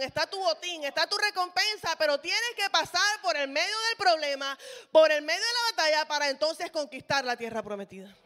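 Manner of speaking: loud, rising preacher cry; fiery preaching. Acted. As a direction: build it like a pulpit climax. Preaching at full force.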